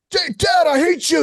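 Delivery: very high-pitched mock tantrum; retold kid's outburst, squeaky-high and mad; imitating angry kid. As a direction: act out a furious child yelling at Dad. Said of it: "he gets pissed off at me".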